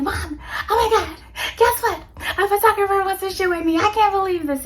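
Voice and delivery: breathless, high-pitched giddy announcement; excited squeal. Retold or imitated. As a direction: gush the news to mom like you can't contain it. Calling mom with big news.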